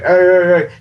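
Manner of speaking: referee calling out. Retold or imitated. Ref holding back a wrestler, calling out. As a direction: play the ref stepping in, voice up. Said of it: "the ref held back Bayley and was like".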